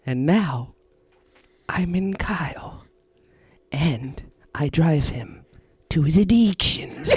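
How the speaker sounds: slow breathy sinister whisper; eerie whisper. Retold or imitated. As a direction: whisper it like a lurking spirit. Creator whispering.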